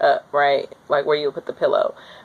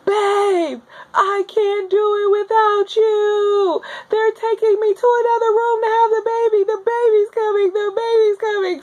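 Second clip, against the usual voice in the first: high, crying call to her husband; yelling down the hallway. Retold or imitated. Wheeled away, crying out to husband down the hallway. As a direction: call out desperately for him. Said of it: "this is me yelling down the hallway".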